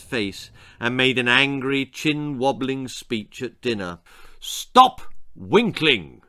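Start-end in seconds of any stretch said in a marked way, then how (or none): 4.4-6.2 slow, loud, stern command; father's shout. Acted. Father shouting at daughter at dinner, loud and slow. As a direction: bark it slowly like a stern father. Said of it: "he shouted".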